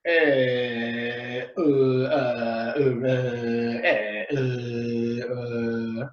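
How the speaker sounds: low drawn-out filler uh sounds; filler sounds demo. Shown as a demonstration. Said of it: "You recognize that?".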